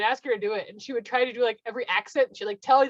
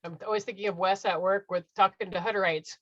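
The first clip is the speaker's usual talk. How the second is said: usual speaking voice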